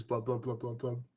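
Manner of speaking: bubbly mumbled blubs under water; underwater blub speak. Pretend. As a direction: gurgle it like talking underwater. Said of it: "just says".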